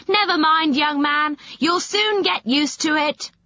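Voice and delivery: high, jeering consolation; crowd calling out. Acted. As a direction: tease the dripping boy. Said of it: "they cried".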